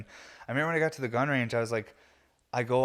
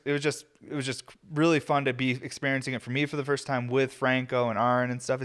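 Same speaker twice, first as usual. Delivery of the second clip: usual speaking voice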